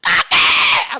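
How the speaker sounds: full-voiced greeting yell, very loud; loud shout. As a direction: holler out to grandpa, drawn out. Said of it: "kamu teriak keras-keras ya".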